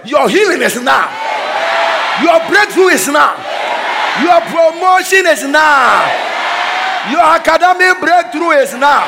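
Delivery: decree it over them; preaching at full force. Acted. Preacher declaring breakthroughs over the church.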